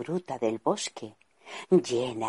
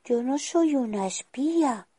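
higher, indignant denial; protesting; 10 semitones higher than usual. Acted. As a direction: push back, hurt she is called a spy. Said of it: "protestó Adelina".

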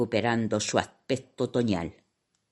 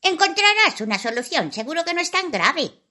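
quick high brush-off; dismissive fairy; faster than usual. Acted. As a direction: wave it away quickly.

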